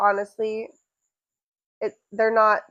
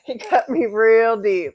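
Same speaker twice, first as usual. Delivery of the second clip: Shrek quote laughing; 6 dB louder, slower than usual. Pretend.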